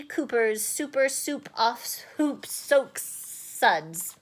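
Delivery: slowly, like a product name; slow mock advert; slow and careful. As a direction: spell it out deliberately. Slow careful tongue twister.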